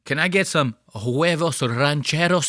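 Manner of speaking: mock Spanish accent with rolled r; overdone Spanish accent. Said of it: "with a Spanish accent".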